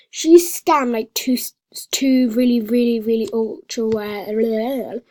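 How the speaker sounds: loud and slow, outraged; angry rant. Real, not acted. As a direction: complain loudly to viewers. Angry at a scammer.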